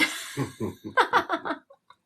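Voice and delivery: high-pitched burst of laughter; real laugh. Real, not acted. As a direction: crack up laughing at your own predicament. Laughs about getting shut down.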